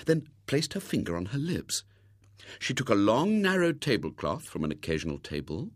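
ordinary delivery, nothing marked

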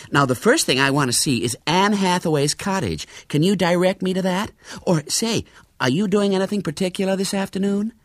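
high, lively American lady voice; eager American lady tourist. A narrator's reading. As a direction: bubble over with sightseeing plans, pitched high.

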